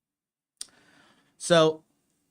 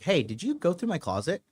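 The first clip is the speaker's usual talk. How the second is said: lighter, higher opening question; casual probing question; 6 semitones higher than usual. Retold or imitated. Man texting his girlfriend about his closet, casual probing. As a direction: ask it breezily, fishing for an answer. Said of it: "And I texted her, I was like".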